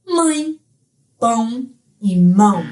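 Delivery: "mãe", "pão", "mão" with nasal vowels; nasalized Portuguese sound. Shown as a demonstration. Teacher showing students a sound absent in Spanish, nasalized. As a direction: let "mãe", "pão" and "mão" ring through the nose. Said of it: "aquele som nasalado".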